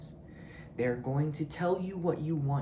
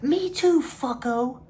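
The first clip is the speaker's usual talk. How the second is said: much higher, louder jeering yell; mocking shout; 10 semitones higher, 9 dB louder, slower than usual. Real, not acted. Yelling at viewers who whine about meditation, mocking shout. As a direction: shout it back at them.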